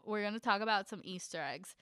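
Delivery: normal talking voice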